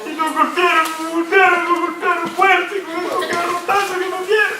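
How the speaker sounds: high, whiny, hammed-up sobbing plea; a pastor and his friend pretend to cry in jail, mock weeping; mock crying prayer. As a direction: whimper to the Father like a beaten man, played for laughs. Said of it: "Tal vez los dos hubiéramos estado llorando".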